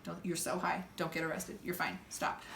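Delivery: a muttered warning to herself, stoned and nervous; inner voice. Retold or imitated. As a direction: quietly tell yourself to stay out of it. Talking herself down, high.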